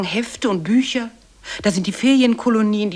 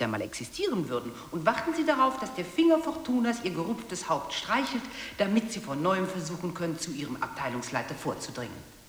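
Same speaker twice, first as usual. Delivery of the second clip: von normal bis fast unhörbar leise verklingend; ausblenden; 11 dB quieter than usual. Acted. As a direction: lass die Stimme langsam wegsterben. Stimme wird ausgeblendet. (wird immer leiser bis zum Verklingen)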